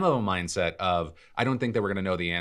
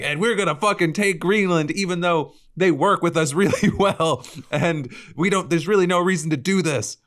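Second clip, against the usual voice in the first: high, loud blustering mock voice; mock bully; 12 semitones higher, 6 dB louder than usual. Mocking leaders bragging about taking Greenland, loud mock bluster. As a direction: sneer it like a greedy bully. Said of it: "and then it's like".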